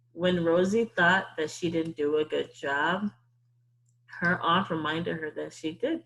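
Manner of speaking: ordinary delivery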